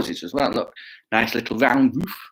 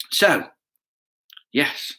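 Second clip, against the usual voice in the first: ordinary delivery, nothing marked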